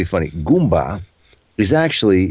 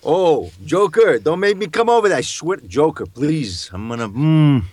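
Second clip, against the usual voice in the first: higher, drawn-out Italian-American pleading as Batman; Harvey Keitel Batman; 5 semitones higher, slower than usual. Pretend. Batman pleads with the Joker as Harvey Keitel would. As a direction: plead with the Joker like a gruff New York gangster Batman. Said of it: "Harvey Keitel and the Batman".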